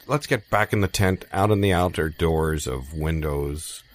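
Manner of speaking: ordinary delivery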